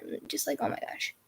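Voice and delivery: whispered exasperated aside; whispering. Real, not acted. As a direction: breathe it out under your breath. Exasperated aside about sister.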